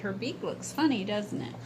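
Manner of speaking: ordinary delivery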